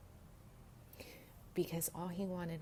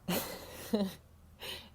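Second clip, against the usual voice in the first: breathy giggle; 3 semitones higher than usual. Real, not acted. Laugh after "cool.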